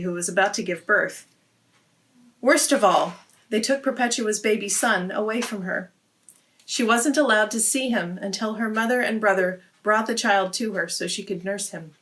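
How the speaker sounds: ordinary delivery, nothing marked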